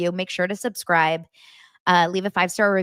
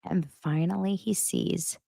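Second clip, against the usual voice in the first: usual speaking voice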